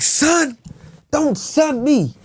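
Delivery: high, slow don't son me; mock indignant. Actor retelling his stage line to dad, high indignation. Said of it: "I'm like".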